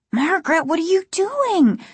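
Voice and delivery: high-pitched alarmed question; high and frightened. A narrator's reading. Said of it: "his voice high and frightened".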